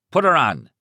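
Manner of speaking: loud, high bark of a command. Acted. Husband demanding the kidnapper hand over his wife, barking. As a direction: bark it down the phone. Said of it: "Thad barked".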